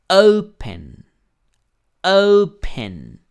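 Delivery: "Open" with a high, loud O, then a falling second syllable; stress on first syllable. Shown as a demonstration. Teacher showing students the stressed syllable, "Open" stressed hard on the O. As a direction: punch the O of "Open", let "pen" drop away. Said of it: "La O es la que tiene mayor fuerza de voz".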